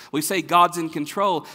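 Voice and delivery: ordinary delivery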